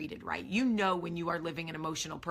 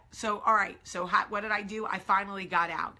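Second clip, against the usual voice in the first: usual speaking voice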